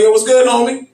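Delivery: hearty retold greeting; upbeat greeting. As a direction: call out a cheery greeting to your friend. Greeting friend in story.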